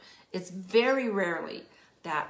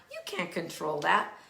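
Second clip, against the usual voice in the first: ordinary talk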